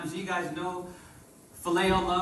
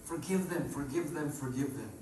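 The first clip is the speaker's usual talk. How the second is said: fading plea; 4 semitones lower, 6 dB quieter than usual. Acted. Jesus pleading, voiced softly.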